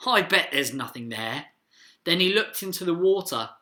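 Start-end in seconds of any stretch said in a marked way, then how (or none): 0.1-1.5 low, dismissive remark; scoffing. A narrator's reading. Dad on the bridge, scoffing. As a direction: scoff in a lowered voice. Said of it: "and scoffed".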